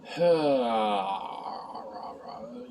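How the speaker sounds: drawn-out, rumbling sigh trailing off; long groaning sigh. Real, not acted. Reviewer fed up with recapping the show, groaning sigh. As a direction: let out a long weary groan.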